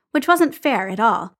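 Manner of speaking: ordinary delivery, nothing marked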